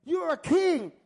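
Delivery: ordinary talk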